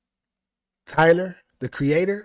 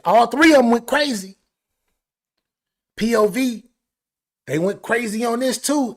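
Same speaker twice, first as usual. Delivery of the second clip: higher, louder hype; excited hype; 7 semitones higher, 6 dB louder than usual. Real, not acted. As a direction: rave about it. Hyped praise.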